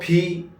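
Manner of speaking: پھی with a puff of air after p; aspirated P. Shown as a demonstration. Teacher explains English P to learners, aspirated پھی. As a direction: say پھی as a breath-released p. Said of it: "عموماً تلفظ کرتے".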